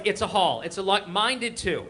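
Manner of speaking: ordinary delivery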